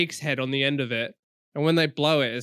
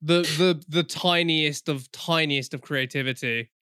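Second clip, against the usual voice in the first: ordinary talk, nothing marked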